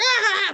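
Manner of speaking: high mock squeal; a burst of hairdresser glee; high squeal of delight; shriek of laughter. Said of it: "she's just like".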